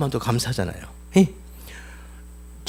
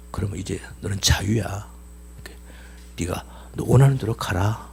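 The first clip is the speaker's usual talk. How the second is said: tender whisper; 9 semitones lower, 6 dB quieter than usual. Acted. Master grants the slave freedom.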